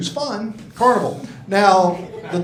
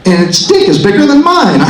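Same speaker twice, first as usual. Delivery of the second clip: laughing outrage; 8 semitones higher, 9 dB louder than usual. Retold or imitated. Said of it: "I'm like".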